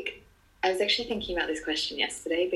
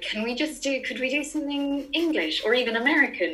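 higher, half-joking plea; mock plea; 7 semitones higher than usual. Actor begging the school for an English play, mock pleading. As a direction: plead lightly, pitched up. Acting out her plea for variety.

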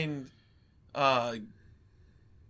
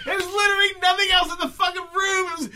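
laughing rant; 9 semitones higher than usual. Real, not acted. Friend gushing about the empty set, laughing shout. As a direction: crack up while shouting about the huge empty room. Ranting about the movie's bare room.

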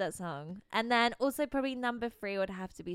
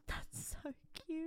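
whispered aww; 4 semitones higher, 12 dB quieter, slower than usual. Real, not acted. Reacts tenderly to story.

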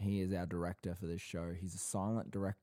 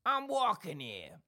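higher, slower New York shout; Dustin Hoffman impression; 12 semitones higher, 4 dB louder, slower than usual. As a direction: play the Midnight Cowboy yell. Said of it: "quote the amazing, uh, Dustin Hoffman quote".

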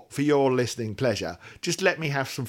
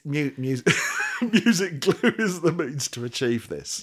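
laughing; 4 semitones higher, 3 dB louder, slower than usual. Real, not acted. Repeating sentence while laughing.

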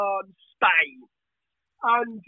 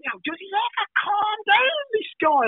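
high, shouted mock disbelief; quoting a baffled DJ, high and shouted; incredulous imitation; 9 semitones higher than usual. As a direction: do Steve exasperated at the hyper guy, pitched way up. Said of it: "And he's like".

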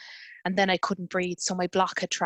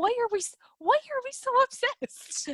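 mock exasperation. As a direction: squeal it out in comic outrage at ourselves. Mocking their own obsession, laughing.

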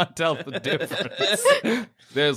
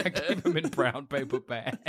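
ordinary talk, nothing marked

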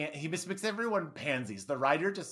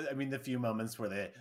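ordinary talk, nothing marked

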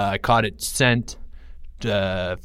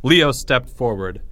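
ordinary delivery, nothing marked

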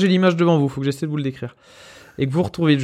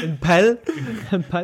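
pelle said pal with a laugh, Lorraine way; laughing, repeat pelle like pal in a Lorraine accent; 5 semitones higher than usual. Shown as a demonstration. Host laughing and showing his Lorraine pelle to friends. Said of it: "avec l'accent lorrain".

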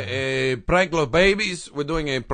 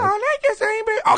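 put-on baby voice; much faster than usual. Baby voice again.

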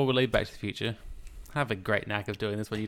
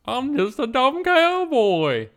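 dumb cowboy voice; 9 dB louder, much slower than usual. Retold or imitated. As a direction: play a dopey cartoon cowboy.

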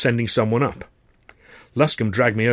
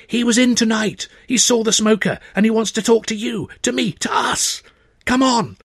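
excited Luscombe; 12 semitones higher, 3 dB louder than usual. Acted.

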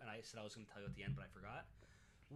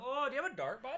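put-on much higher, louder laughing Canadian accent; mock Canadian accent; 11 semitones higher, 9 dB louder, much faster than usual. Joking with cohost, a high laughing Canadian accent. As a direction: squeal it out Canadian-style through laughter. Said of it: "Canadian accent".

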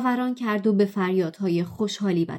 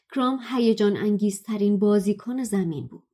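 ordinary talk, nothing marked

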